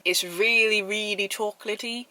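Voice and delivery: slow, drawn-out attempt at a British accent; muddled mock accent. Vlogger reviewing a chocolate cookie, muddled British accent. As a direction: put on an unplaceable, would-be British accent. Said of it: "what this accent is".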